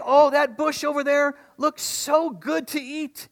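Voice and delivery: high, slow bleating sheep voice; wandering sheep. Acted. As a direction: play a greedy sheep eyeing a bush. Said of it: "They look over there and they say".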